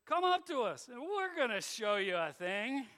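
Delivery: loud, high jeering taunt. Acted. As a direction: call down to them mockingly. Philistines taunting Jonathan.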